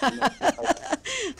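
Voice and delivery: high chuckling laughter; amused laugh. Real, not acted. Host amused by own plan to attend.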